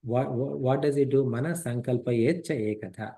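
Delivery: normal talking voice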